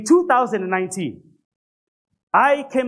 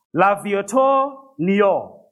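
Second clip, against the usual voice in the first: slow, drawn-out French accent on L'Aviator Niort; mock French accent; much slower than usual. Shown as a demonstration. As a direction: put on a French accent for the car name, slowly. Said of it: "let me get that French accent going".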